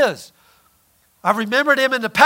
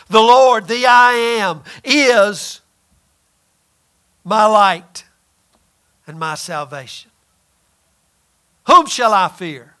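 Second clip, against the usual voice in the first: proclaimed psalm; slower than usual. Acted. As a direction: declare it like scripture from the pulpit. Preacher quotes the psalm.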